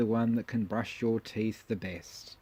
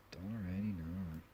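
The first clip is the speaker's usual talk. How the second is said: Dollar eighty-nine, repeated much softer and falling; soft disbelieving echo; 4 semitones lower, 10 dB quieter than usual. Real, not acted. Laughing at an old wine ad, softly repeats the price. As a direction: mutter the price back, unimpressed.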